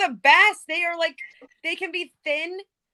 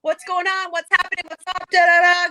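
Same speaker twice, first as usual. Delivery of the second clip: higher, hyped-up factory hellos; hyped greeting; 4 semitones higher, 4 dB louder than usual. Retold or imitated. Walking into the factory at six, all hyped. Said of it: "I'm just like".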